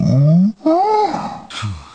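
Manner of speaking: long loud exasperated sigh; fed-up sigh at the endless chores. Acted. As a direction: huff out a big weary sigh. Sigh after "Busy.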